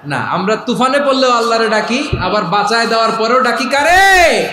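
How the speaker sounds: high, building to a shouted question; rousing preaching. Real, not acted. Preacher demanding the crowd's answer, rising to a shout.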